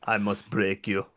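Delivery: put-on low Russian-accented movie villain; Dolph Lundgren impression. Podcast host answering the dialect coach joke, mock Russian accent. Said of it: "Dolph Lundgren comes on the scene".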